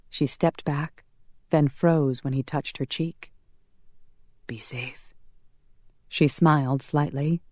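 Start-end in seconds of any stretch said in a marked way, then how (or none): 4.5-5.1 breathy whispered goodbye, barely audible; whispered farewell. Acted. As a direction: breathe it to her like a tender parting. Tender farewell at closet door.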